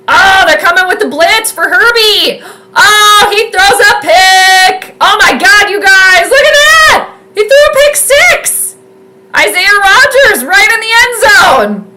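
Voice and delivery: high, loud, real excited yelling; excited shouting. Real, not acted. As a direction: call the pick six like a thrilled fan. Pick six reaction live.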